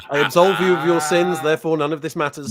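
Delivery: loud, high, lightly priestly proclamation; mock absolution. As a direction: proclaim forgiveness with playful pomp. Mock absolution after the permission question.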